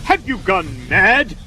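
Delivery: high, drawn-out indignant cry; outraged. Acted. Actor to servant who grabbed parcel, outraged. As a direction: demand it slowly in sheer outrage.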